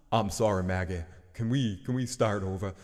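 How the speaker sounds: low, subdued villain apology; mock-contrite bad guy in a put-on accent; Negan. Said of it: "that's my Negan voice".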